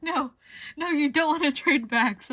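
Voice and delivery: high, loud warning no; urgent warning. Retold or imitated. Friends warning her not to trade, high and loud. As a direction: call out to stop a trade. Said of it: "they were like".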